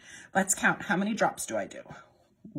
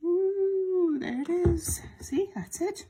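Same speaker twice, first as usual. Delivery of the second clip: delighted ooh; 11 semitones higher, 4 dB louder, slower than usual. Real, not acted. Soap touches the milk.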